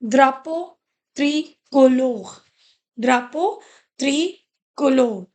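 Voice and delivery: "Drapeau tricolore" spoken slowly, syllable by syllable, the final r nearly dropped; slow French model. Shown as a demonstration. Teacher modelling "Drapeau tricolore" for students, slow and careful. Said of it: "So how do you pronounce it?".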